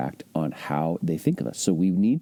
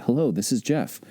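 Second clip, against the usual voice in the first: warm; 6 semitones higher than usual. Shown as a demonstration. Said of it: "do you sound warm".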